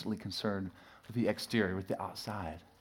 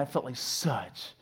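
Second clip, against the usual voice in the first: normal talking voice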